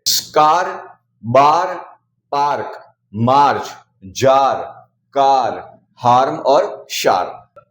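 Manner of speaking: स्कार, बार, पार्क, शार्प said with a long open "aa" vowel; aa sound demo. Shown as a demonstration. A teacher drilling the A-before-R rule: a long "aa" vowel. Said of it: "ए को आ पढ़ते हैं".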